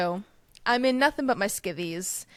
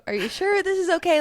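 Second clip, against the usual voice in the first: worried self; 9 semitones higher, 5 dB louder than usual. Retold or imitated. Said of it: "I'm like".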